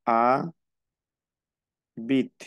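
"a ... bit", long pause between, ending on T; split into syllables. Shown as a demonstration. Teacher breaks word down for student, "a ... bit" in syllables. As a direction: hold "a", pause, then "bit" with no final E. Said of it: "¿qué te queda?".

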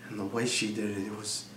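hushed, low, brooding delivery with long pauses; brooding confession. Acted. Jealous husband confiding in Frankie about his wife, hushed and brooding. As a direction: confide it softly and low, letting pauses hang. Brooding opening of dramatic monologue.

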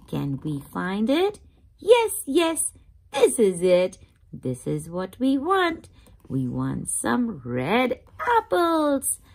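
plain reading voice

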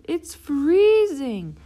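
high, loud, slow shout; stretched-out yell of freezing. Acted. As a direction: yell it at the cold stethoscope.

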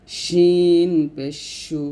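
শিন পেশ সু drawn out; letter drill; slow demo syllable. Shown as a demonstration. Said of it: "যেমন".

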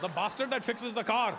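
the indignant Indian dad, laughing it out; dad's Indian accent. Retold or imitated. Dad explaining mechanics to his son, in a high Indian accent. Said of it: "give everything an accent".

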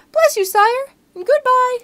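very high, loud little mouse squeak; frightened mouse pleading with the lion, squeaky. Acted. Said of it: "mouse farewell".

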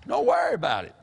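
imitating an old preacher's reassurance; Brother J T Lyons. Quoting a fellow believer telling folks not to worry, exclaiming. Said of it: "Brother J T Lyons".

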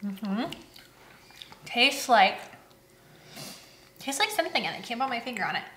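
talking while chewing; mouth partly full. Real, not acted. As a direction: food in mouth. Seen 1: Closed-lip chewing at start; holds bitten candy while talking after.

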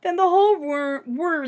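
plain reading voice

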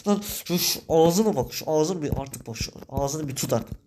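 ordinary talk, nothing marked

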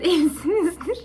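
normal talking voice

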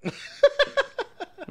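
shrill burst of laughter; high laugh. Real, not acted. Laugh after quote.

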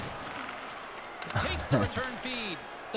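soft, high giggling; tickled chuckle; laugh. Real, not acted. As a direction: laugh quietly at your own joke. Laughs at his own joke.

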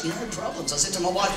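ordinary talk, nothing marked

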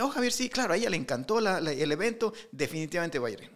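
bright high-pitched secretary voice; a cheery assistant promising big; excited assistant. Retold or imitated. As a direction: sing it out like an eager assistant.

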